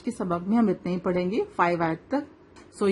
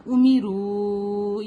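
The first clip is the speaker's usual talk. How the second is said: امروا with the final "roo" held long; long madd; much slower than usual. Shown as a demonstration. Teacher demonstrates three-to-five counts, long madd. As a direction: slowly chant امروا, stretching its last vowel. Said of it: "three to five count اس کو لمبا کریں گے".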